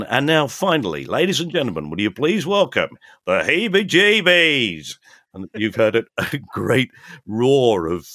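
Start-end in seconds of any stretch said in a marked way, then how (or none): 0.0-5.0 compère announcement. Retold or imitated. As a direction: boom it out like a concert MC. Announcer introducing the band.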